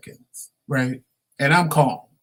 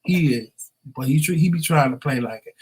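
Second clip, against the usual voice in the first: ordinary delivery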